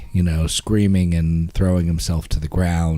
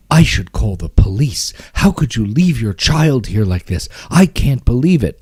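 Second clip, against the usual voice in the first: louder, indignant rebuke; angry scolding; 5 semitones higher, 5 dB louder than usual. Retold or imitated. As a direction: scold a stranger for leaving his child. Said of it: "from concern to some kind of anger".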